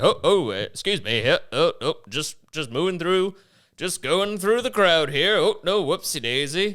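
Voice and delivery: overly polite sorry-eh voice; polite Canadian. Retold or imitated. Mocking Canadian police riding through protesters, politely apologetic.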